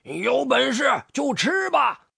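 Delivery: defiant grandpa toad shout; fierce roar. A narrator's reading. Grandpa toad faces down the hungry snake, fierce shout. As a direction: shout a fierce dare at the enemy. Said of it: "爷爷凶狠地吼道".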